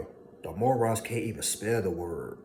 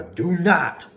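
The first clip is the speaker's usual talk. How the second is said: high, loud bellow of do not; sudden shout; 10 semitones higher, 12 dB louder than usual. Real, not acted. Warning listeners, shouting do not. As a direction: blast it out. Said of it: "shouted command".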